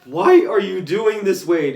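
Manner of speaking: high, loud, slow plea; pleading Professor X. Retold or imitated. Professor X pleading with Wade, loud. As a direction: beg him to explain. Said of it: "and he's like".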